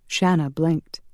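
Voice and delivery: plain reading voice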